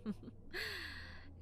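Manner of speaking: high little giggle; soft giggle. Acted. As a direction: giggle sweetly at the patient. Playful giggle in roleplay.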